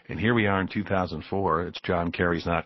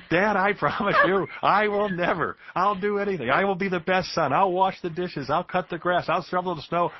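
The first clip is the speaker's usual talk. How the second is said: put-on much higher, desperate child begging his father; pleading child; 11 semitones higher than usual. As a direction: beg your dad like a scared kid, voice pitched way up. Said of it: "I'm sobbing uncontrollably".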